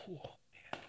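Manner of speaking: breathless whisper of wonder; soft, stunned whisper; awed whisper. Retold or imitated. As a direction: hush it as if watching a whale rise beneath you. Awe watching a whale.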